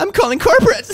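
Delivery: high, loud, shrieking threat; entitled customer shout. Acted. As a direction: scream it like an outraged Karen. Karen escalating complaint.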